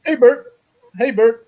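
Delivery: Ernie. Acted. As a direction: call out like a children's show puppet. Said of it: "Ernie will go".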